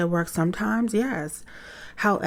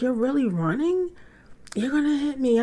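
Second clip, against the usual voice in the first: incredulous; 9 semitones higher than usual. Retold or imitated. Mother stunned son flees.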